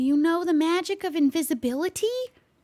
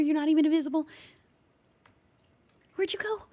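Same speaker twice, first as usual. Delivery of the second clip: ordinary talk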